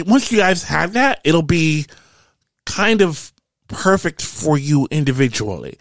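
Quentin promising. Acted. Game master plays Quentin.

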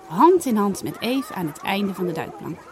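usual speaking voice

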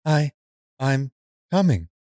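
stilted, halting robot delivery. Acted. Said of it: "not so much like a robot".

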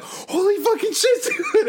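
high laughing squeal of excitement; friend freaking out. Retold or imitated. Friend [name] running up after spotting [name], squealing laughing excitement. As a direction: squeal it like a fan losing it. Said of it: "he was like".

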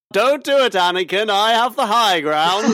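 impassioned shouted warning to a former friend; Obi-Wan Kenobi. Acted. Podcaster quotes a favourite Star Wars line, Obi-Wan impression. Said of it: "That's a good Obi-Wan Kenobi".

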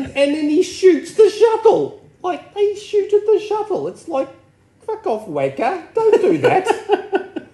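high-pitched incredulous rant, laughing through it; comic high-pitched indignation; mock outrage. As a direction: scold in exaggerated disbelief, voice shooting up. Mock outrage at Riker shooting shuttle.

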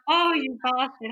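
loud playful indignation; retold mock outrage. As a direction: scold him in mock offence. Retells mock outrage at proposal surprise.

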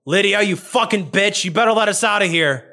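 high, furious yell at Lydia; angry shout. Acted. Prisoner Katrina yelling at Lydia, furious high shout. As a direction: scream at her through the cage. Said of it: "you hear Katrina go".

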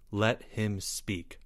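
slow, soft, controlled order; commanding. A narrator's reading. Leo orders the crowd to hear Albert, quietly commanding. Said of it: "Leo commanded".

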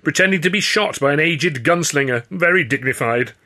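indignant, needling comeback; spitting back. A narrator's reading. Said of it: "Hurley spat back".

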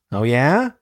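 understated eager question, rising at the end; a touch of relish on a rising question; mild enthusiasm. A narrator's reading. Narrator reads the attacker's reply to victim, mildly enthusiastic. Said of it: "enthusiastic tone in voice".

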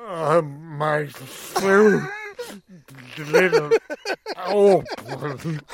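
very slow, loud, mangled-mouth voice; injured survivor. Retold or imitated. Parachute survivor tells his story, painfully garbled. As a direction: labour each word out like a badly broken man. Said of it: "see the guy telling the story".

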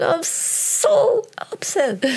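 very high, slow, strained "I'm so upset"; drawn-out high wail of frustration; retold fury. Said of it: "kicked the door in the hall".

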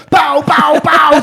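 high, loud mock pistol shots; Lucky Luke gunfire. Retelling restaurant joke as loud cowboy shots. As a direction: blast them out like a cowboy. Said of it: "Som en jävla Lucky Luke".

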